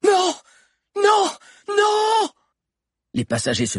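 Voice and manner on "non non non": three very high, loud separate screams; high-pitched desperate yells; screaming in shock. A narrator's reading. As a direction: howl out in refusal and horror. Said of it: "hurla soudain Zizo".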